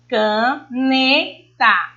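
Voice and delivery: ca... NE... ta, syllable by syllable; stress on the middle syllable. Shown as a demonstration. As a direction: split caneta in three and lift the NE. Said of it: "elevo mais a minha voz na sílaba né".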